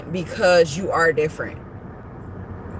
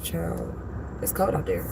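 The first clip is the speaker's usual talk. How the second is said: low sassy aside; 13 semitones lower, 9 dB quieter than usual. Retold or imitated. Telling viewers she hates the cold, low and sassy. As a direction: drop the voice way down, sassy and dry. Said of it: "I be like".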